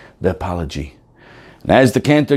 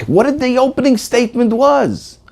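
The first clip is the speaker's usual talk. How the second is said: ordinary delivery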